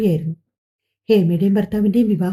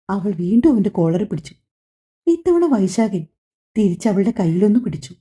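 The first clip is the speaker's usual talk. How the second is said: usual speaking voice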